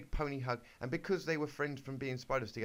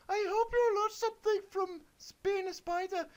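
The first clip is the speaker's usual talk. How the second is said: put-on very high, piping girly voice; squeaky Miriam the wizard; 6 dB louder than usual. Reading the kid's story, Miriam tells the spiders in a very high squeak. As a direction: squeak it like a little pony wizard.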